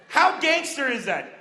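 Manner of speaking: usual speaking voice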